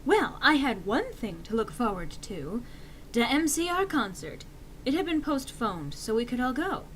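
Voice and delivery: ordinary delivery, nothing marked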